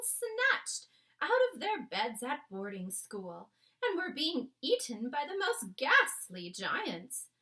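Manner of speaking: a queen upset after a bad night. The Queen tells her maid Mary about the dream, regal and shaken. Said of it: "a very well-known voice".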